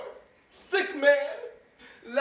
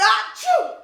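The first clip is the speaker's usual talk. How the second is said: loud sneeze; 10 semitones higher, 7 dB louder than usual. Acted.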